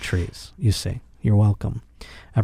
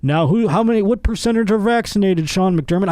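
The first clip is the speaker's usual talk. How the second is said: higher-pitched reporter firing a question; pestering reporter; 7 semitones higher than usual. Retold or imitated. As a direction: put on a mock press-conference question, pitched up. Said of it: "I don't wanna hear".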